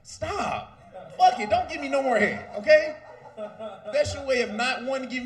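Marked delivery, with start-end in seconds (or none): 0.0-2.9 louder, exasperated telling her to stop; fed up, shove the words out louder; mock fed-up shout. Man yelling at his partner to quit, exasperated.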